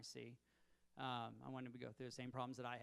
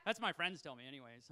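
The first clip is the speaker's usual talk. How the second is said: ordinary delivery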